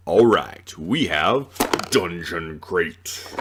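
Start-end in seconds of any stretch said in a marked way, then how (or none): 1.9-3.3 slow, deep announcer drawl of the name; dramatic title read. Acted. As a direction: say it like a movie trailer.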